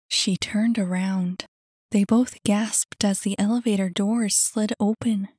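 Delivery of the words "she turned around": ordinary delivery, nothing marked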